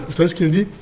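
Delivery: ordinary delivery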